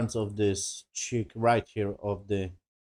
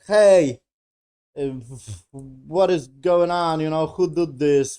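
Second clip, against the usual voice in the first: raised, higher mock outburst; retold dramatic confrontation; 4 semitones higher, 6 dB louder, slower than usual. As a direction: call out as if demanding answers in a retold quarrel. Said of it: "we got drama".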